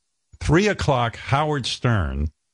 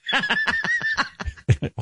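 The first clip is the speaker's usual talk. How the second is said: high shrieking laugh. Real, not acted. Squealing laugh.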